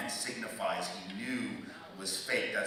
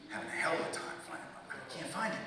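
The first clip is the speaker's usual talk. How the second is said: normal talking voice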